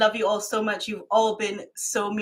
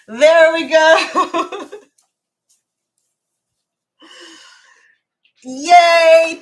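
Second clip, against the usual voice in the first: high, loud, very slow cheer with a laugh; overjoyed cheer at the award reveal; excited cheer; 8 semitones higher, 12 dB louder, much slower than usual. Real, not acted. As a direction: celebrate the reveal, shouting yay. Revealing her award on the wall.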